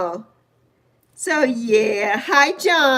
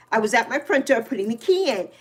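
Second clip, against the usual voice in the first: ordinary delivery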